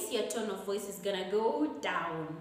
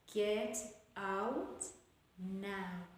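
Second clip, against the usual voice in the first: soft command, pause before a sunken "now"; word by word, letting "now" fall away; falling intonation; 2 semitones lower, 7 dB quieter, much slower than usual. Shown as a demonstration. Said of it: "So it's falling".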